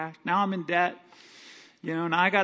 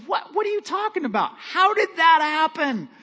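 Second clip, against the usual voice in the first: much higher, alarmed wife's voice; shocked wife; 10 semitones higher, 5 dB louder than usual. Pretend. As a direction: squeal it in disbelief. Wife shocked by pig news.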